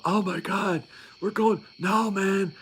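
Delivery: high, whiny panic; mock meltdown. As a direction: imitate a recruit falling apart. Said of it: "guys that were literally having meltdowns".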